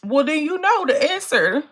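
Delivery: high, loud and fed up; sassy loud comeback; exasperated retort. Real, not acted. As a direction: throw the question back at them. Retorting to a viewer's question.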